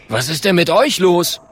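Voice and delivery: bluff, punchy question; puzzled caveman. Acted. Klonk asks his frightened friends, puzzled.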